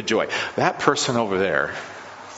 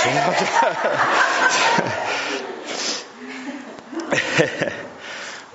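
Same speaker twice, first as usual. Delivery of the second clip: breathy chuckle at himself, slightly higher; 5 semitones higher than usual. Real, not acted. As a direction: laugh it off after the drumming. Laughs at losing his breath.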